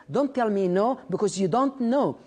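every word of "don't tell me no because you don't know" stretched and weighted; a deliberate, spaced-out motivational punchline; slow emphatic English. Shown as a demonstration. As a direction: drive the English line home slowly, word by word. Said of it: "عارف كلمة no".

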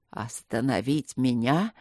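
low, slow rustling menace; rustling evil voice. A narrator's reading. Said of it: "прошелестел голос". (шелестящий зловещий голос)

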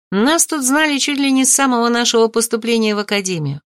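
usual speaking voice

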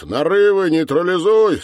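loud, high-pitched command; shouted order. A narrator's reading. Captain shouting orders to the sergeant downstairs, yelling. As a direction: yell the order down the stairs. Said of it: "Капитан кричит".

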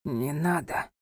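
low, soft, weak voice; weak exhaled refusal. A narrator's reading. Wounded husband refuses hospital, weakly exhaled. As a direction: breathe out a faint refusal. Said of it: "выдохнул Анхель и слабо улыбнулся".

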